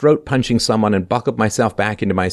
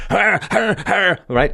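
mocking; 10 semitones higher, slower than usual. Real, not acted. Mocking stammer, high pitch.

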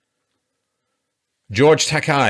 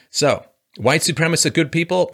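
mock question; 7 semitones higher, 4 dB louder than usual. Mocking strawman claims.

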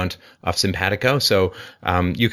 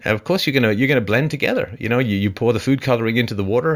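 ordinary talk, nothing marked

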